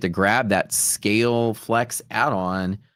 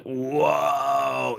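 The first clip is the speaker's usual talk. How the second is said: long high drawn-out "whoa"; awed whoa; 5 semitones higher than usual. Retold or imitated. Streamer retelling walking into grand houses, awed. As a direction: stretch it out high in mock awe. Awed reaction on entering mansion.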